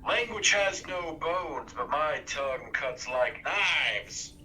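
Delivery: very slow, deliberate taunt; slow dramatic delivery. Acted. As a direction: draw out each word like a spell. Bard casting vicious mockery.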